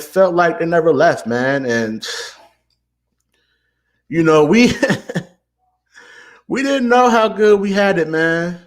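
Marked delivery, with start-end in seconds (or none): 4.4-8.6 rueful laugh. Real, not acted. Laughs ruefully at lost past.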